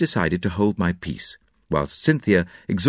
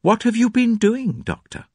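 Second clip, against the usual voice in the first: high-pitched startled question; astonished cry; 13 semitones higher than usual. A narrator's reading. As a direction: cry out at the doctor's state, voice raised in pitch. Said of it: "cried Missus Cavendish".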